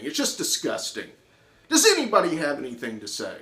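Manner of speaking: high, loud contemptuous rant; scornful tirade. Retold or imitated. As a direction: pour scorn on the whole class. Teacher berating whole class.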